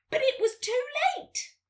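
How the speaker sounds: high, loud story climax; dramatic reveal. A narrator's reading. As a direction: exclaim the fox's defeat. Fox realises he has lost.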